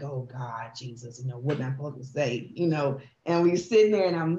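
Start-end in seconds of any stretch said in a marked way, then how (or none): 0.0-2.5 softer and lower, a worried prayer to herself; anxious inner prayer. Retold or imitated. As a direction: murmur it as a private plea. Inner prayer at sick friend.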